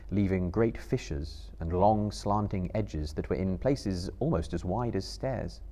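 ordinary delivery, nothing marked

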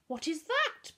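exclaiming. A narrator's reading. Bertolt spotting the beetle in the jar, exclaiming. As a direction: blurt it out in surprise. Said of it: "Bertolt exclaimed".

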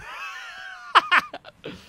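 high-pitched burst of laughter; sudden squealing laugh. Real, not acted. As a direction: cackle high after a mock threat. Laughs after banning the chat.

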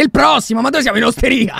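loud mock call-out; imitating a yeller. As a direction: mimic someone bellowing for customers. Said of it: "continua a urlare".

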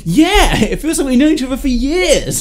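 put-on high, loud, laughing drunk gush; hammed-up drunk voice, laughing; mock drunk. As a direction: gush like you're tipsy and overfamiliar. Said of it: "if I was really drunk".

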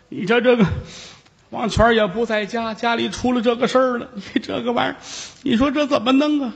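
put-on high, whining old woman; old aunt weeping, high and helpless; crying old aunt.

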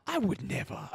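put-on high, soft accented denial; lightly put-on African accent, scandalized denial; imitated interviewer. Interviewer denies he would, in a mock African accent. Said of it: "And he goes".

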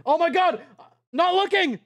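ordinary talk

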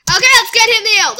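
high, loud rallying call; urgent shout. Real, not acted. Gamer rushing to heal a fallen Pokemon, urgent shout. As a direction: burst out loudly to rally.

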